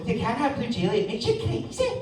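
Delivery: high, soft Scottish mum voice; Scottish mother. Retold or imitated. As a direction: scold a child in Scottish accent.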